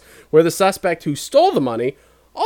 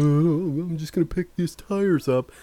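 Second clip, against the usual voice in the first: softer, lightly voiced thief; casual thief's voice; 4 dB quieter, slower than usual. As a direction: act the thief strolling over casually. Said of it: "he's, like, walking over, like".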